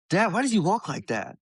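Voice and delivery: put-on high-pitched little kid question; imitated child. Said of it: "my kid, and goes".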